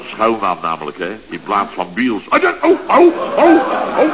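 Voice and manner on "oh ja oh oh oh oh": geschrokken uitroep. Acted. Schrikt van verspreking over schuilnaam.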